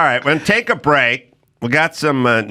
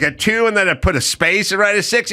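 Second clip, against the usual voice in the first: usual speaking voice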